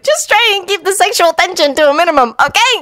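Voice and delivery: put-on high-pitched young ninja, teasingly helpful; agreeable Naruto. A narrator's reading. Naruto advises Sasuke, high agreeable voice. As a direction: pitch it high as the chirpy teammate.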